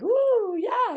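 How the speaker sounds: high, gleeful little whoop; acted thrill of being appreciated; tingly delight. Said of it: "how does it feel when we get appreciated".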